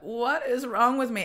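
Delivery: imitating her own cry of despair. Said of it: "And I was like".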